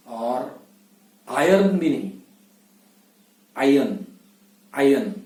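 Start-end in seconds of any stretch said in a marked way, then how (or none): 3.6-5.1 आयन said with no r sound; आयन, the r kept silent; silent r in iron. Shown as a demonstration. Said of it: "आर होता है उसको silent रखना है".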